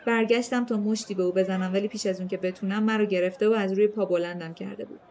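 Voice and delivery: ordinary delivery, nothing marked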